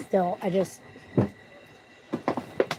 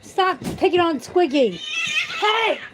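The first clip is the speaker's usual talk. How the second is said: slow, much higher angry shout; shouting at cats; 12 semitones higher, 6 dB louder, slower than usual. Real, not acted. Defending Squiggy from the other cats, loud shout. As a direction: yell to stop the bullying.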